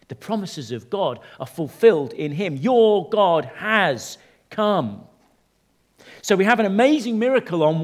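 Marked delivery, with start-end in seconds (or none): 2.6-5.0 high, drawn-out words, each set apart; slow proclamation. Real, not acted. Preacher declares the promises fulfilled, slow proclamation. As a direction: proclaim it word by word, very slowly. Climax of the point.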